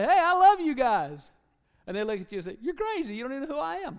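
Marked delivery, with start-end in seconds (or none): 0.0-1.3 loud, high, drawn-out acted hello; exuberant greeting. Acts out greeting a room. 2.6-4.0 high-pitched mock protest; acted disbelief. Acts the startled strangers' reply.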